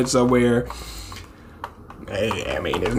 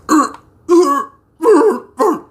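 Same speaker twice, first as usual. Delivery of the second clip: strained grunts; slower than usual. Acted. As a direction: grunt in short high bursts. Imitates character's straining grunts.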